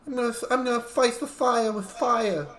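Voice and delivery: high-pitched put-on voice; hammed-up high impression; fantastic impression. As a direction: push the voice way up into a silly character. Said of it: "my fantastic impression".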